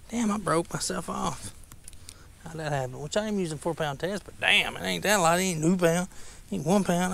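normal talking voice

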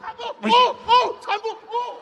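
high, hammed-up yelling; over-the-top frightened shrieking; overacted shriek. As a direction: ham it up like a chimp. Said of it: "你跟个黑猩猩似的".

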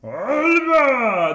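loud high battle cry; shouted Alba. Retold or imitated. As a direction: yell it like a drunk knight. Imitating the Russian Alba shout.

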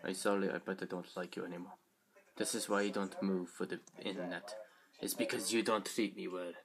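low, quiet sulky robot character. Acted. Toy robot refusing to perform for the internet, low sulky voice. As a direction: grumble softly in a put-on accent. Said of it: "I picture the B B eight voice like this".

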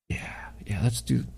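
a quick hushed whisper. Real, not acted. Whispering to viewers while choosing a clip. Picking a clip to play quietly.

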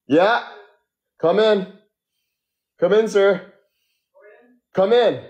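high-pitched call to the door. Real, not acted. Guest calls to hotel worker at the door, calling out. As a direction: call someone in from across the room.

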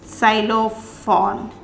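Xylophone said slowly, ksülofon; slow model word; German Xylophon. Shown as a demonstration. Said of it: "we can say xylophone".

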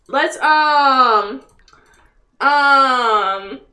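ordinary delivery

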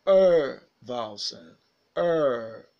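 a loud, drawn-out "er", one steady central vowel; long er vowel. Shown as a demonstration. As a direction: hold "er" long and forceful, as a model sound. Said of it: "this is also a long vowel sound".

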